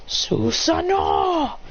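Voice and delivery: high, slow, stretched power call; summoning call. Acted. Fighter activating his power, calling it out. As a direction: draw the name out high. Character calls out his technique.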